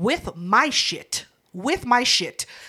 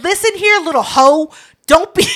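loud, high-pitched retold scolding; losing it; 5 semitones higher, 7 dB louder than usual. Fed-up adult scolding a four-year-old, losing it. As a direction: tell off a kid, loud and indignant. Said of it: "I lost my shit".

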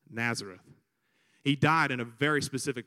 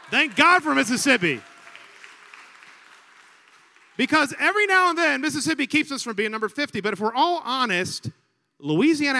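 much higher and louder, fast run; fired-up preaching; 8 semitones higher, 7 dB louder than usual. Real, not acted. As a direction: work the congregation up. Preaching the joke to the crowd.

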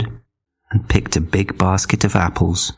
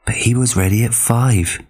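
ordinary delivery

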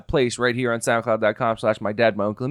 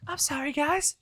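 mock pop-star whimper, way up high; 4 dB quieter than usual. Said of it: "the worst [name] I've ever heard".

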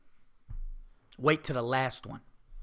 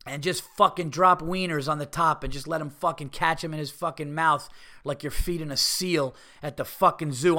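ordinary talk

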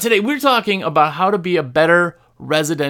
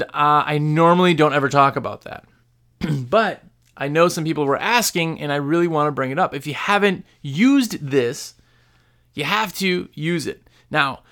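ordinary talk

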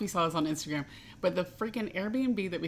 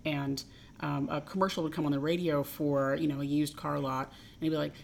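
ordinary talk, nothing marked

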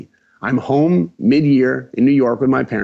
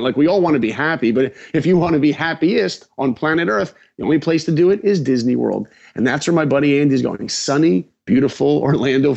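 usual speaking voice

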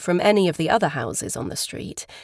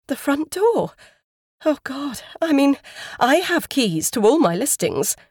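higher-pitched, flustered woman; dismayed realisation; 8 semitones higher, 4 dB louder than usual. A narrator's reading. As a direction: let alarm creep in as it dawns. Woman realises her keys implicate her.

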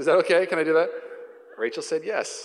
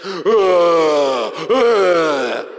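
loud roaring ah like a beast; Susie's beastly roar; 8 dB louder than usual. Retold or imitated. Susie roaring in the water fight, loud beast roar. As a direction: roar at him like a bully. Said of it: "Susie's like".

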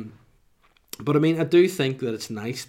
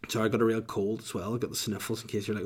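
ordinary talk

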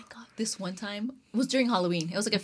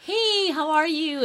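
forced cheery hello; 8 semitones higher, much slower than usual. Retold or imitated. Forced greeting in the store.

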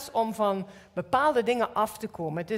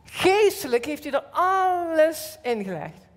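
higher and louder, slow build; preaching fervor; 7 semitones higher, 5 dB louder, slower than usual. Acted. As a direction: proclaim it with rising fire. Preaching climax.

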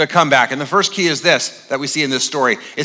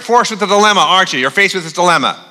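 ordinary delivery

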